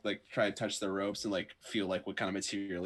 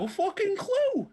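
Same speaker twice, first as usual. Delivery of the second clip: very high, slow, loud drawl; high silly voice; 6 dB louder, slower than usual. Acted. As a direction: drag it out very high. Player answers in character.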